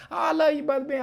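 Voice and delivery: high gushing fan voice, hammed up; mock adoring fan. Mimicking viewers on his livestream, high gushing. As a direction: squeal it like an overeager admirer. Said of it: "So I used to say".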